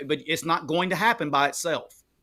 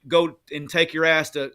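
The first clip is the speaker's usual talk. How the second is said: usual speaking voice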